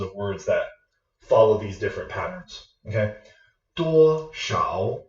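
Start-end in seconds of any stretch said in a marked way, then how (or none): 3.7-5.0 "Duo" high and level, "shao" rising at the end; first plus second tone. Shown as a demonstration. Teacher reviewing example words for viewers, tone combination. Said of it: "Duo is a first tone".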